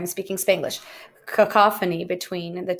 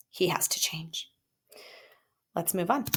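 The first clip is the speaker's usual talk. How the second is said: whispered aside, much softer; 9 dB quieter than usual. Acted. Teacher whispering a joke about a relationship, hushed. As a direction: breathe it as a secret.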